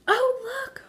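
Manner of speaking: put-on child seeing the gift, burst of wonder; whispered awe. Girl seeing the doll by firelight, high excited child voice. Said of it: "whispered Amelia".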